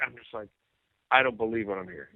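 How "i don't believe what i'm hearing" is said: understated, softer retold reaction; stunned disbelief. Singer alone at his answering machine, quiet disbelief.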